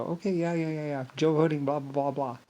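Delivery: soft, offhand mock agreement; half-hearted yeah-yeah; placating. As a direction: go along with it quietly to be nice. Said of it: "I tried to be nice".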